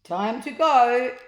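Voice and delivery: slow, loud command; loud slow commanding call; ordered. A narrator's reading. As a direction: order them out. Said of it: "ordered Ned".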